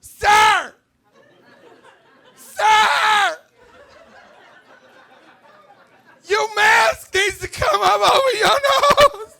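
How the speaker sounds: very high, loud, slow, squeaky whine; mock a panicky young store clerk policing masks; whiny Gen Z clerk. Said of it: "some little millennial or Gen Z".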